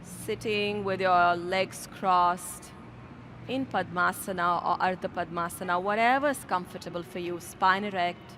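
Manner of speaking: yoga teacher guiding the class into meditation, softly; guided meditation. Acted. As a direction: settle them in, low and gentle. Leads into seated meditation.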